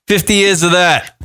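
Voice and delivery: ordinary delivery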